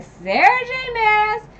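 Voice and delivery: high-pitched, loud mock outrage at the author; a hammed-up fan meltdown, slow and shrill; mock outraged rant. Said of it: "then I was mad 'cause I was like".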